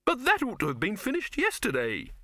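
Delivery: high, plaintive wail of a fretful director. A narrator's reading. Silverfish wailing that the filming is late, in a high plaintive wail. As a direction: whine it out like a put-upon director. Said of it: "wailed Silverfish".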